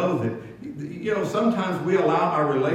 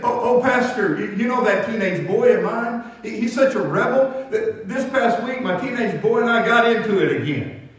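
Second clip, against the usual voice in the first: higher and louder, a deacon pouring out his trouble in a rush; distraught deacon; 5 semitones higher, 4 dB louder than usual. Retold or imitated. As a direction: play the upset father spilling it all to his pastor. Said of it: "he was all distraught".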